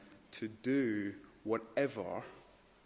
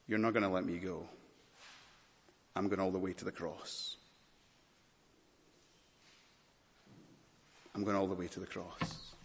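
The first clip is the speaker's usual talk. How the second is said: quiet resolve; 4 semitones lower, 6 dB quieter than usual. Acted. Voicing Jesus' silent resolve.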